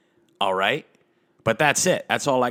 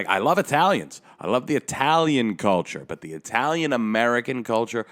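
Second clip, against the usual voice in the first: ordinary talk, nothing marked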